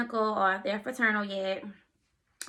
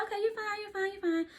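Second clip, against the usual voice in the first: much higher, placating voice; imitated reassurance; 12 semitones higher than usual. Ultrasound tech calming an anxious patient, high imitated reassurance. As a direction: mimic a high-voiced "don't worry". Nurse soothing her during anxiety.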